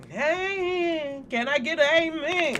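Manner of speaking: high, drawn-out mock preacher call; playful church call-out. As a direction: call out to a congregation in jest. Playful church-style call for amen.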